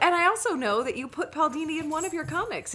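bitter accusation. Retold or imitated. Slighted fan confronting the comic's writer, bitterly accusing. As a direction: confront them with mock indignation. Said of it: "starting to get bitter".

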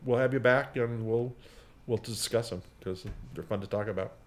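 ordinary delivery

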